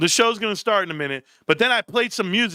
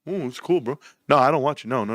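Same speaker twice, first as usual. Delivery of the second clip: ordinary talk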